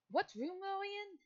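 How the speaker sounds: high curious question. Acted. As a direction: ask brightly, pitching it way up.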